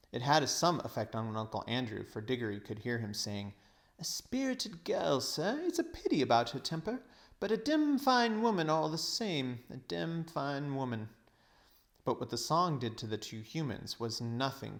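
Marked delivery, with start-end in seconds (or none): 4.0-11.1 put-on old roué's admiration. Uncle Andrew murmuring admiration for the witch, leering. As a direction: savour it like a smitten old rake.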